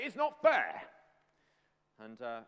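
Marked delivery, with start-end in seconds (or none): 0.0-0.9 loud, high mock tantrum; whining protest. Us when something goes wrong in life, high loud complaint. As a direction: wail it like a wronged child. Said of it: "and we say".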